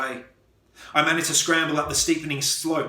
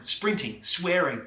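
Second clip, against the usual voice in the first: ordinary delivery, nothing marked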